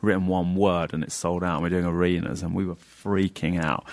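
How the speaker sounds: ordinary talk, nothing marked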